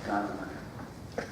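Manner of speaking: soft, reverent musing prayer; hushed prayer. Acted. Preacher prays softly before the congregation, hushed and reverent. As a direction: breathe the words out in quiet awe. Quiet worshipful prayer refrain.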